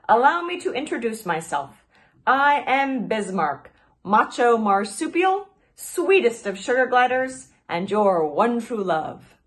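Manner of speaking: grand proposal. Acted. Grand self-introduction to the fox.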